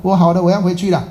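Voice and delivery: high, eager announcement of recovery; excited patient. Retold or imitated. Patient telling hospital staff he is leaving, excited. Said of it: "那个医护人讲说".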